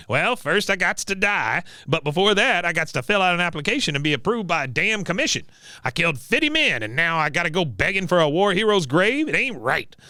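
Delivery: cranky veteran voice, pitched high; Cotton. Acted. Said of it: "He goes".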